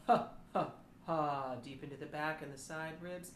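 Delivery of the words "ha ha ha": short low ha pulses. Shown as a demonstration. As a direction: punch low has from the belly. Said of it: "First the low".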